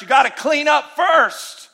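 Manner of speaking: a touch of a stern church elder; mock church scold. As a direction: parody a legalistic church voice. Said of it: "we've heard in the church".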